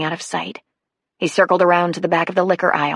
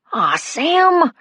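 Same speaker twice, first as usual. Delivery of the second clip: put-on high, drawn-out teenage whine; whining; 10 semitones higher than usual. A narrator's reading. Caught boy to the cop taking him away, high whine. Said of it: "Jason whined".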